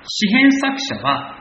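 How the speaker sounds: ordinary talk, nothing marked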